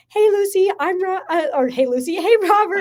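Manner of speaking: high, loud, flustered introduction; nervous greeting. Retold or imitated. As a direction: stumble over the hello, laughing at yourself. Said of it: "went up very nervous".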